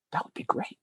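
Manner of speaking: soft unvoiced request; photographer's whisper. Photographer Greg asking for tears, in a soft whisper. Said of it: "he goes, like".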